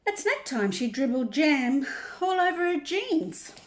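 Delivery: picture-book reading with big pitch swings; reading to children. Acted. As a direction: draw out the key words for young listeners. Reads picture book aloud.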